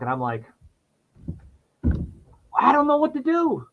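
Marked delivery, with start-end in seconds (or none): 2.6-3.7 high, helpless wail; walking away from the door, lost, in a high helpless wail; despairing whine. Retold or imitated. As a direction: let the voice crack up high. Despairing on sidewalk.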